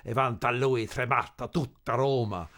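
spoken Italian declamation, measured and weighty; declaimed opera line. Retold or imitated. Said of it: "They only said".

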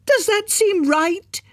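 very high, slow, drawn-out outrage; outraged question. A narrator's reading. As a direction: stretch out the final demand. Climax of widow's tirade.

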